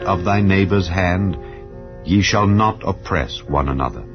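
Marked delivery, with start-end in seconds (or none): none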